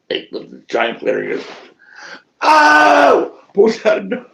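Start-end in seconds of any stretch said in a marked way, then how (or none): 2.4-3.3 high, loud drawn-out yell; imitated loud cry. Friend lifting a giant planter, acted loud high cry. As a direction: let out a long acted "oh!".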